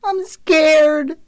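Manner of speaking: put-on high, drawn-out little-boy voice; squeak. A narrator's reading. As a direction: squeak it like a frightened child. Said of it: "Milo squeaked".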